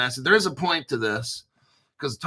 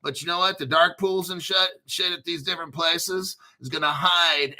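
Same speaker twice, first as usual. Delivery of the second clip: usual speaking voice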